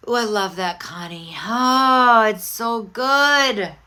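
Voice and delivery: loud, drawn-out delight; a big slow gush of real pleasure. Real, not acted. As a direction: savour every word of it, slow and loud. Delighted reaction to a comment.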